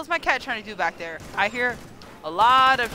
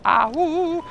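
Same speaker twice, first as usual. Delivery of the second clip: short, high playful howl; wolf howl; 4 semitones higher, 3 dB louder than usual. Pretend. Howls for the wolf pack.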